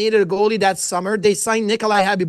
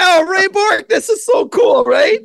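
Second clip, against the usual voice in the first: much higher, louder fanboy cry; starstruck shout; 10 semitones higher, 6 dB louder than usual. Retold or imitated. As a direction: yell it like a kid meeting his hero. Retold reaction to Ray Bourque's call.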